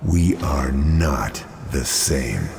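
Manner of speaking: slow, loud and cold, each word landing; defiant retort. Acted. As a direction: flatly refuse the comparison. Hero rejecting villain's comparison.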